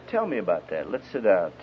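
low, soft invitation to talk; gentle counselor. Retold or imitated. Preacher counseling a troubled husband, gently concerned. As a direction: draw him out quietly and tenderly.

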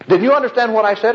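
high, quick acted challenge; testy challenge. Deacon challenging the preacher who misheard him, high, quick and testy. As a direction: fire off the retold question, pitched well up.

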